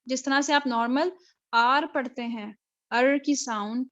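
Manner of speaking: usual speaking voice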